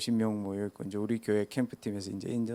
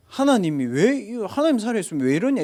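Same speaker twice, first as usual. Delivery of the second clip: arguing unbeliever; 9 semitones higher, 8 dB louder than usual. Retold or imitated. Grieving father challenging God, loud and high. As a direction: confront God like a bitter skeptic. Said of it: "불신자 따지듯이".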